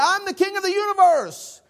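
very high, loud mock-regal proclamation; grandiose, hammed-up shout; deluded king. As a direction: crow it high, like a madman on a chair. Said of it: "Harry said".

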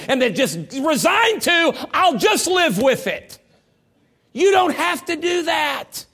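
loud, high and slow; preaching shout. Acted. Preacher urging struggling couples, loud and slow. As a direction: hammer it home to the pews.

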